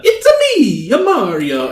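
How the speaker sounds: very high, loud cartoon catchphrase voice; hammed-up high-pitched game mascot; Mario impression. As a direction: squeal it out in a sky-high cartoon pitch, dropping on the name. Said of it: "he'll do the".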